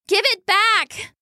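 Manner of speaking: high, drawn-out little weasel protest; cross demand. A narrator's reading. Sister wanting her tapestry from brother, demanding. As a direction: insist slowly in a high, indignant voice.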